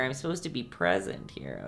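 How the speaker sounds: ordinary talk, nothing marked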